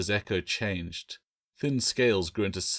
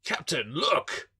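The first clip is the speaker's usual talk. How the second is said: higher, excited call out; Jackson's shout; 7 semitones higher, slower than usual. A narrator's reading. Jackson calling the captain to look, excited. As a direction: call the captain over in wonder. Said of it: "Jackson shouted".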